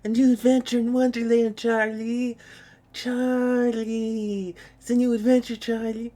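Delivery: high, sing-song cartoon unicorn voice; Charlie the Unicorn impression. Streamer to chat, quoting a cartoon in a high unicorn voice. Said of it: "I'm gonna do Charlie the Unicorn".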